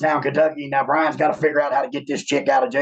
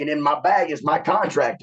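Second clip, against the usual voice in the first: ordinary talk, nothing marked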